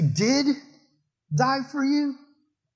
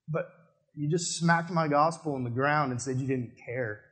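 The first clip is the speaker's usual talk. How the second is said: lightly voiced Jesus, low and softer, grieving; sorrowful Jesus; 5 semitones lower, 5 dB quieter than usual. Jesus to the one who smacked the gospel down, quietly grieving. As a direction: grieve over the gospel thrown away. Jesus rebuking at heaven's gates.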